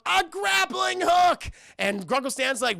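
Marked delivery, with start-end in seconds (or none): 0.1-1.5 loud, high mock-epic shout; excited announcement. Mabel has found her prize, shouted with excitement. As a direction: belt out the big reveal.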